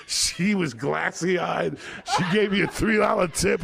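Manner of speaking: rising comic shout to the punchline; building to a shout. Real, not acted.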